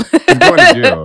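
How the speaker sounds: high, loud, slow through laughter; laughing. Real, not acted. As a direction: squeal it while laughing. Laughing joke.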